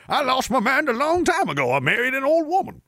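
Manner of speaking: high-pitched yokel caricature; hammed-up hillbilly. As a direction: answer in a silly high hillbilly voice. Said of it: "Why do you talk like that?".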